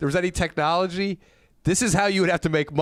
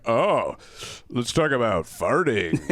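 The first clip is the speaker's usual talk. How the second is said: low-pitched comedian impression; Jim Painter; 6 semitones lower than usual. Imitating how the comedian still talks, low impersonation. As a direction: drop the pitch and imitate his way of talking. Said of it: "He still talks like that, so he's like".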